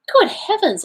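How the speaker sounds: high-pitched cry of astonishment; startled exclamation. A narrator's reading. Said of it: "I exclaimed".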